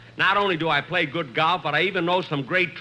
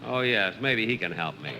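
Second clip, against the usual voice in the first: usual speaking voice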